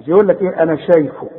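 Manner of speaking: usual speaking voice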